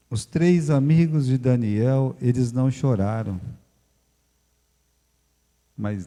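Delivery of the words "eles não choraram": ordinary delivery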